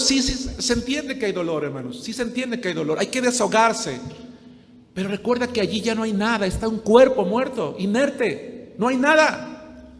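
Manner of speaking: loud, high preaching that swells on each phrase; fervent preaching. Acted. Pastor preaching to the grieving congregation, loud and fervent. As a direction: preach it out to the congregation with full conviction.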